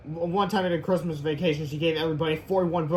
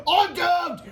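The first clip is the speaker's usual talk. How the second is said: high, louder wail; mock despair; 13 semitones higher, 5 dB louder than usual. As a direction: cry out doomed. Hero trapped.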